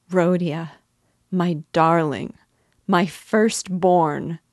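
cling to each word through tears; sobbing. Acted. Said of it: "she said, sobbing".

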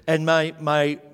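normal talking voice

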